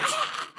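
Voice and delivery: very high short squeal; quick high-pitched "Ah!" imitating a freak-out; imitated squeal. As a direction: mimic a startled squeal, pitch leaping up. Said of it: "she literally squealed".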